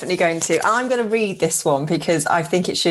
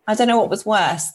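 ordinary talk

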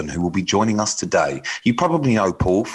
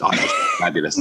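sassy; faster than usual. Pretend. Showy self-description.

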